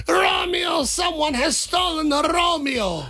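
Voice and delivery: put-on high, slow wailing Italian accent; crying old Italian man. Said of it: "I said, / old Italian man".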